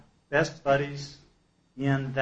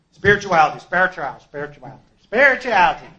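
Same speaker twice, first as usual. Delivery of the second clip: mocking repetition; 5 semitones higher, 11 dB louder than usual. Mocking repeated buzzword.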